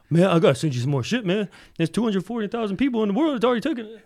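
high, loud, hurried friend impression; mock-exasperated rant that climbs and speeds up; freaking out. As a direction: imitate a buddy freaking out on you. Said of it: "freaking out on me".

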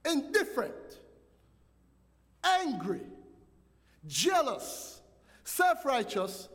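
fervent preacher pressing the point; preaching at full force. Acted. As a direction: build the charge like a pulpit climax. Preacher builds to a climax.

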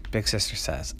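plain reading voice

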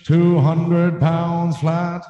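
high, loud, very slow boxing intro; ring announcer. Pretend. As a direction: stretch every word like a fight-night MC. Answers with his own ring intro.

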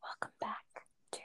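soft unvoiced whisper intro; ASMR whisper. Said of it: "Do, should I do some A S M R?".

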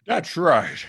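usual speaking voice

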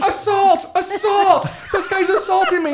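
very high, loud cries of alarm; hammed-up, high-pitched accusation; mock outcry. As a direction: imitate someone crying assault for all to hear. Acted outcry after being bumped.

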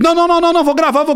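mock the guy who won't talk and just keeps filming; imitating a heated refusal. Retold quarrel with Arthur.

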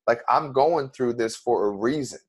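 ordinary talk

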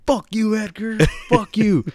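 high mock-angry friend, playful; mock angry brother. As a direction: fake fury at a buddy for laughs. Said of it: "the very first thing he was like".

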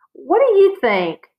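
high, loud, slow angry shout. Acted. Boy with a hurt nose shouting at his sister. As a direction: snap it back at her, shouting. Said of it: "he shouted".

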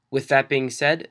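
ordinary talk

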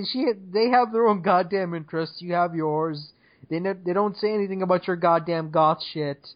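normal talking voice

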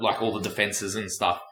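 normal talking voice

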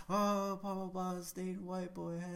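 put-on high, teasing kid voice; mocking kids' voice. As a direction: mimic the little kids teasing.

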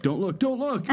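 high-pitched imitation of a panicked mother; mock panicked warning. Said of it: "And you were like".

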